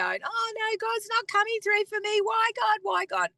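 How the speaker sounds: high-pitched, whiny imitation of doubt; mock despairing wail. A doubter looking at circumstances and crying to God, mock despair. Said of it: "look at the circumstances and go".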